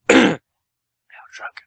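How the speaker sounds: normal talking voice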